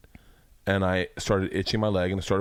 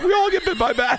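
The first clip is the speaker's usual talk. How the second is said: mock shout; 6 dB louder than usual. Mocking the Costa Ricans' attitude.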